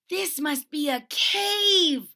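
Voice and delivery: slow, high, loud gasp of wonder; a small bear marveling at a cave; awed little bear. Acted. Little bear in the laundry bag, slow and awed. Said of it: "he said".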